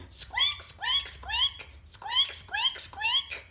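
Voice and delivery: tiny, very high-pitched squeaks; squeaking. Acted. Imitating the squeaking noise from inside the egg, squeaky. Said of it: "heard a squeaking noise".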